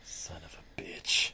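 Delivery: hissed under the breath; whispered curse. Real, not acted. Angry aside about doctor.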